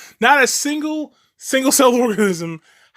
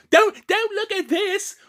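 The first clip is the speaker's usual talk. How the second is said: high, hammed-up British outrage; mock British accent; 12 semitones higher than usual. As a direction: squawk it in a put-on British accent. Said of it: "I'm making fun of British accents".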